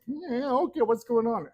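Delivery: high, puzzled, asking what's going on; high surprised quote. Retold or imitated. Walking in on chaos in his store, high and puzzled. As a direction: lift it up high, mock surprise. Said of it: "I'm going like".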